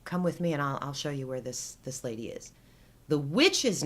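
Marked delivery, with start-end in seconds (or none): 0.0-2.5 conspiratorial whisper, fading. Retold or imitated. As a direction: lean in and whisper the secret. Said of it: "The neighbor's kid says".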